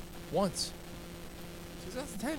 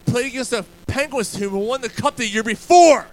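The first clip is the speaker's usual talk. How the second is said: heated arguing; 5 semitones higher, 5 dB louder than usual. Real, not acted. Heated sports argument.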